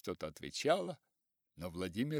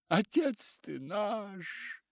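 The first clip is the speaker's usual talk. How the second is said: high, slow, pleading cry; 10 semitones higher, much slower than usual. A narrator's reading. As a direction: call out to the master. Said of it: "кричали они".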